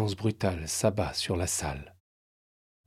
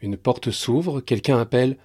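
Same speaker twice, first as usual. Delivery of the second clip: ordinary delivery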